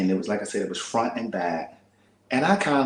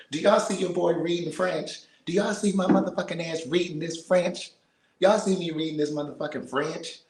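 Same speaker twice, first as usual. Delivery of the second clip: excited bragging; 7 semitones higher than usual. Real, not acted. Proudly reading French to her viewers.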